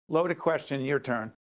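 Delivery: ordinary delivery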